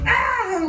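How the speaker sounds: put-on squealing like a teenage girl; fangirl squeal. Telling the audience about meeting [name], high starstruck squeal. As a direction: shriek like a starstruck fourteen-year-old. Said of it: "like fourteen-year-old girls".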